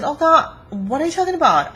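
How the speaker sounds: high, loud disbelieving outburst; incredulous objection. Retold or imitated. Viewer scoffing at the coach's advice, incredulous. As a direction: protest as if the idea is absurd.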